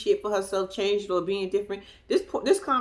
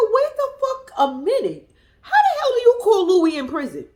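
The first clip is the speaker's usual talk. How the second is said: mock outrage; 9 semitones higher, 4 dB louder than usual. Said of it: "I said".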